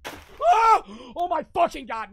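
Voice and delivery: screaming in sudden fright; jump-scared shriek; terrified scream. Real, not acted. Jump scare in horror game.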